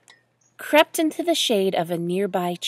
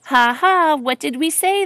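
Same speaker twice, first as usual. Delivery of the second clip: loud, higher spoken ha-ha taunt; mock laughing; 4 semitones higher, 6 dB louder than usual. A narrator's reading. Other ducks laugh at Duffel, mocking. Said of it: "laughed the other ducks".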